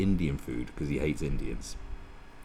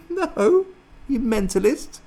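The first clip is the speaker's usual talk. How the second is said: squeaky-high, loud disbelief; mock incredulous; 6 dB louder than usual. Host scoffing at turning her down, high-pitched incredulity. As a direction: shriek it as if it's absurd. Mocks the idea of refusing.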